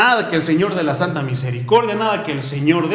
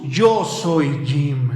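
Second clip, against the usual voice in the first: very slow, lower, each word held; slow pleading declaration; 5 semitones lower, much slower than usual. Retold or imitated. Street sweeper imitating the homeless man, very slow. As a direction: stretch the words out low.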